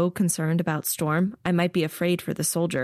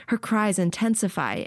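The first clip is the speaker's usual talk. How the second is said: ordinary delivery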